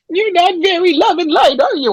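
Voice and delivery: high, mock-offended whine; hammed-up hurt reproach; upset fluffy healer. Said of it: "she gets all upset and goes".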